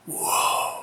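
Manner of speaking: breathless unvoiced whoa of awe; crowd gasp. Preacher voicing the crowd's gasp: hushed breathy whoa. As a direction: gasp it out softly like a stunned crowd. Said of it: "you can just hear a gasp".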